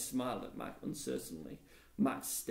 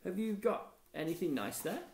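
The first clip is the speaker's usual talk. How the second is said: higher, bright and carrying; raised voice; 7 semitones higher than usual. A narrator's reading. Lisa greeting Mac across the street, in a raised bright voice. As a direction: call it out sweetly so he hears. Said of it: "She raised her voice and said".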